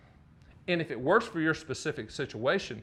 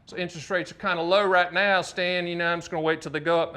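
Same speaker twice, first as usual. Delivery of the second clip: imitated Southern caller, a touch louder; 4 dB louder than usual. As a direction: make excuses in a Southern accent. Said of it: "I can do Southern".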